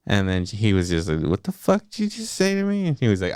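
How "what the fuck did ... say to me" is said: high-pitched incredulous challenge; mock affronted. Husband reacts to his wife's insult, mock affronted. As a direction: play up offended disbelief, voice jumping high.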